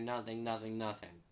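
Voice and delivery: normal talking voice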